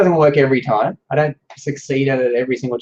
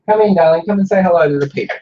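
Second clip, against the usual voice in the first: higher, louder call to the next room; yelling across the room; 6 semitones higher, 5 dB louder than usual. Real, not acted. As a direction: call her in from across the house. Calling daughter into the office.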